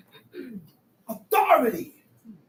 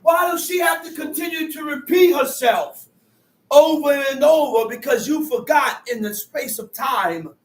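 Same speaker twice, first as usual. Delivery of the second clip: fiery preaching; 4 semitones higher, 3 dB louder than usual. Acted. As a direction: drive the point home to the flock. Preaching on honoring mother.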